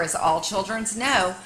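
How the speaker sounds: plain reading voice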